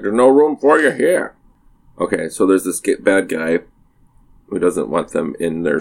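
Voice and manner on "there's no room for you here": gruff stranger. Retold or imitated. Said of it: "He's like".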